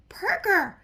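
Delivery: sharp high shout of the name; loud startled shout; yelled name. Acted. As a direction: yell it out in shock. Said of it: "Jameson yelled".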